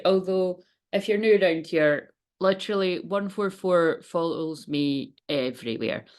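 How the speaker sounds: normal talking voice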